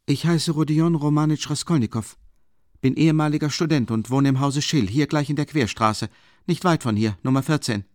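high-pitched, insistent character voice; Raskolnikow declaring himself. Acted.

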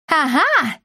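triumphant cry. A narrator's reading. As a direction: cry it out as you spot your prey. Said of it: "крикнула Бия". (торжествующий возглас)

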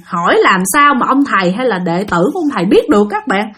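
plain reading voice

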